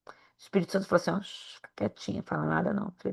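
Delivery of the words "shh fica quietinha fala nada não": unvoiced whisper, barely audible; whispered divine shushing; whispered hush. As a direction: hush her like a secret warning. Holy Spirit hushes her.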